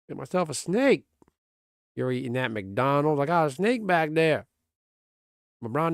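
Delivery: lightly put-on Cajun accent teasing a friend; mock Cajun accent. Teasing Greg about bayou life, hammed-up Cajun accent.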